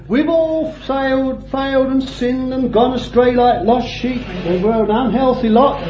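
high, droning churchgoer's confession; mock recital. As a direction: parody the weekly Sunday litany. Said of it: "Every Sunday they go and say".

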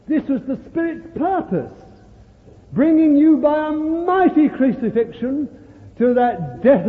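high, ringing sermon delivery; fervent preaching. Real, not acted. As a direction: proclaim it with mounting fervour. Preaches at full force.

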